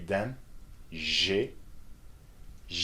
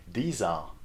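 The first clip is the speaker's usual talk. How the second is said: ordinary talk, nothing marked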